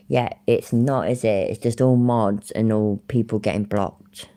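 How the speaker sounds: ordinary talk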